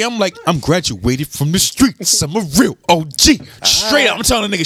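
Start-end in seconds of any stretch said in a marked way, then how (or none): 0.5-3.4 lower, half-serious street boast; mock tough talk. Imagining facing T I with no security, mock tough talk. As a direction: lay it down low like a veteran.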